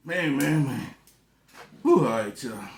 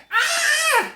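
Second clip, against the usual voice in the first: very high, loud cartoon baby cry; mock newborn wail; 7 dB louder than usual. Man acting out his own birth, mock baby wail. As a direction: imitate a baby's scream at full pitch. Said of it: "And I started crying".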